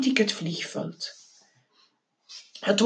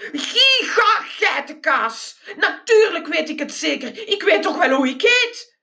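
pitched up, slowed on the cry of giga geitenkaas; roaring outburst; 8 semitones higher than usual. Acted. Geronimo bellows at the driver about his own name. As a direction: explode at the dim driver. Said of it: "En ik brulde".

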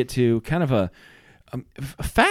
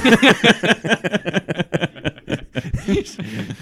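laugh; 4 semitones higher than usual. Real, not acted. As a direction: laugh out long. Laughing after murder joke.

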